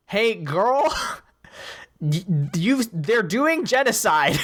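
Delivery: mock Luthen. Luthen reminding Mon of genocide, sassy and slow. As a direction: drawl it like a knowing friend.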